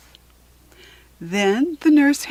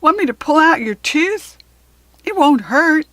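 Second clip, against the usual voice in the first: dad voice; 6 semitones higher, 6 dB louder than usual. A narrator's reading. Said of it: "My dad asks".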